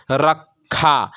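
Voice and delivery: रखा drawn out very slowly, ra-khaa; slow demo. Shown as a demonstration. Teacher to pupils, slowly sounding out रखा. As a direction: stretch रखा to show its sounds. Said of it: "जैसे हिंदी में हम".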